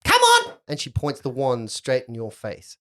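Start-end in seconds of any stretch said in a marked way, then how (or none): 0.0-0.5 loud orders, ending in a high yell; bossy little mistress. Acted. Girl to servant about pouring tea, imperious.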